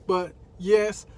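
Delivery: ordinary delivery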